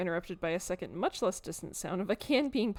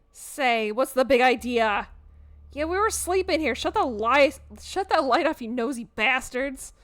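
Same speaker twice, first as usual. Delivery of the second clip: loud, higher, cranky bat yelling; angry little creature, much louder; grumpy bat voice; 5 semitones higher, 10 dB louder than usual. Acted. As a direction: shout like a woken bat, furious at the light. Woken bats yell at intruders.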